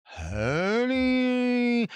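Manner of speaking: high, very slow, stretched "honey"; slow, intense, high-pitched "honey"; exaggerated drawl. Shown as a demonstration. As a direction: pull "honey" up and hold it long. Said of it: "the more serious you are".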